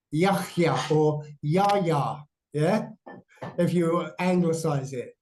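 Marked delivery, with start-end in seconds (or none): 0.1-2.2 Yahya said twice, slowly, with a y and a throaty h; careful sounding out. Shown as a demonstration. Teacher repeats the name Yahya slowly. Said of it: "it's Yahya".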